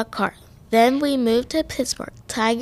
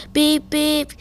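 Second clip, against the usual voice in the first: higher-pitched honking warning; raised-pitch read-aloud street warning; instructing; 5 semitones higher than usual. A narrator's reading. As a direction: call out a car horn, then the order. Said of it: "some man instructed".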